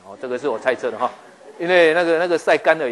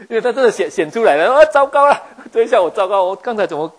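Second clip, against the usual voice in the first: high, loud startled panic; Gideon's sudden fright, voice jumping up; panicked alarm; 8 semitones higher, 9 dB louder than usual. Acted. Preacher acting Gideon's fright in a loud, high panicked voice.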